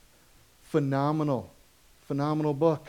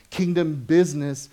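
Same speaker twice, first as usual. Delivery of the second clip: usual speaking voice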